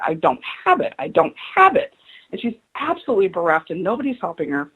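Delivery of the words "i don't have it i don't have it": climbing much higher with each repeat; imitated desperate crying. Stranger sobbing into her phone at the airport, rising desperate plea. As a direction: retell her pleading, rising into a wail. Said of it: "she's crying on the phone going".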